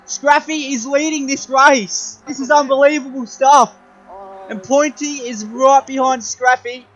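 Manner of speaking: excited race commentary; motorsport commentator. Acted. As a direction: call the race live, building up. Live race play-by-play.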